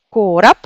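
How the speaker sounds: "короб" said "korap"; "короб" with a reduced O and final P; Russian vowel reduction. Shown as a demonstration. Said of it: "O devient A".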